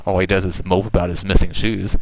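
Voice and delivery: ordinary delivery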